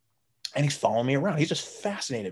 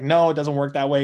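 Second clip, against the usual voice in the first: louder, flat correction; a louder, matter-of-fact no; correcting firmly; 2 semitones higher, 8 dB louder than usual. Retold or imitated. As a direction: set them straight. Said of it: "he's like".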